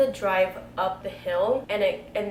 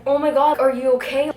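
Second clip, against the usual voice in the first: much louder, higher worried cry; alarmed; 4 semitones higher, 9 dB louder than usual. Retold or imitated. Seeing blood on her friend's chest, alarmed cry. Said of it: "I'm like".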